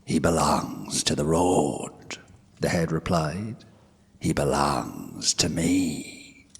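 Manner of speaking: cold creature voice. Acted. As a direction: lay claim, slow and deathly calm. The Dullahan claims the horse.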